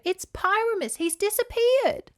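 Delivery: high, rising alarm; alarmed great aunt. Acted. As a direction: voice the aunt breaking the bad news. Aunt reports missing cat.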